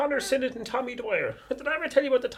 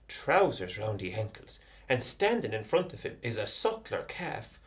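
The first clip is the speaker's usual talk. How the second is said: ordinary delivery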